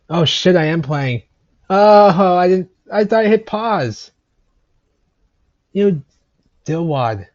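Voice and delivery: sudden high, loud oh; startled outburst. Real, not acted. Vlogger realizing he never hit pause, startled yelp. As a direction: caught off guard, yelp it. Realizes recording still running.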